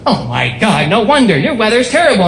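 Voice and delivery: exaggerated dismay of a passer-by; astonished woman. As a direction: swoop upward in mock horror. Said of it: "She goes".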